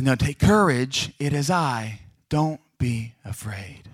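slow, deliberate, spaced-out words; measured reassurance. Acted. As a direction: repeat it slowly and steadily for the panicking. Jesus calmly repeats to panicked disciples.

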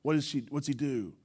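usual speaking voice

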